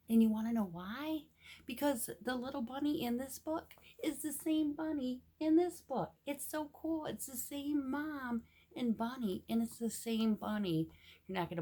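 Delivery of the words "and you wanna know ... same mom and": building excitement, louder and higher; excited. Real, not acted. Teacher excitedly tells kids the same bunny appears, excited. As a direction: reveal the secret with growing thrill.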